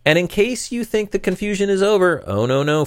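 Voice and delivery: high, sustained teasing lead-in; mock-dramatic warning. Real, not acted. Host warning listeners the confusion isn't over, mock-dramatic. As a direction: raise the pitch high for playful foreboding.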